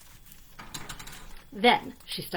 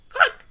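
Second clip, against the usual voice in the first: short high cluck; 12 dB louder than usual. A narrator's reading. Hen clucking, much higher.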